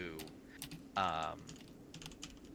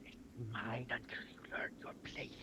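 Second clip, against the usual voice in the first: whispered threat; 2 semitones higher, 7 dB quieter than usual. Acted.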